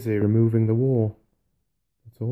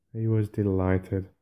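ordinary delivery, nothing marked

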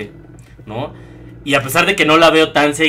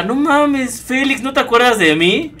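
higher, surprised friendly greeting; excited old classmate; 7 semitones higher than usual. Retold or imitated. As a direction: greet a long-lost friend, delighted.

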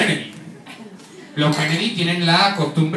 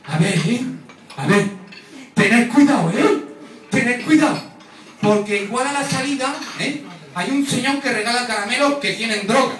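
raised-pitch mother's warning at the door; higher, insistent mock motherly caution; 6 semitones higher than usual. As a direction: imitate an anxious mum cautioning her kids.

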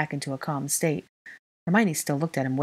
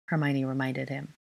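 usual speaking voice

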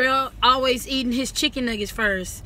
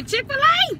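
high sing-song prompt; 13 semitones higher than usual. Shown as a demonstration. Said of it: "Everybody say".

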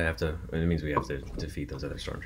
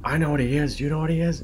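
raised pitch, a little louder, mock dad; imitating the dad; 10 semitones higher, 4 dB louder than usual. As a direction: play the dad building to the reveal. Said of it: "his dad just goes".

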